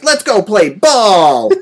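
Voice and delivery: hammed-up dubbed child line; mock overdubbed little kid.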